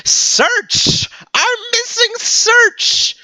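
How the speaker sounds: "Search" and "search" thrown out very high and drawn out slowly; mock dramatic shout of "search"; emphasizing search. Shown as a demonstration. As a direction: punch "search" high and long, like a sudden realization. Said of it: "I am emphasizing the word".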